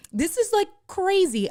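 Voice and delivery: excited disbelief. Real, not acted. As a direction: squeal it, overwhelmed by the story. Telling the dramatic hospital moment.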